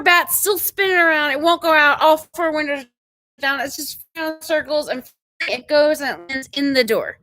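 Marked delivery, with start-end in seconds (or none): none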